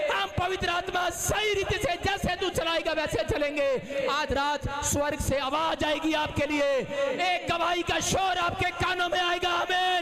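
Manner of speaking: high, loud preacher's proclamation; loud high revival preaching; full-force preaching. Acted. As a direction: proclaim it over the crowd, shouting.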